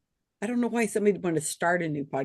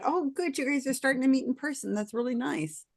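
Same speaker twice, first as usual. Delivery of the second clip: high, quick and pleased; cheery inner thought; 5 semitones higher, 5 dB quieter than usual. Retold or imitated. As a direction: chirp it out brightly, pleased. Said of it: "I thought".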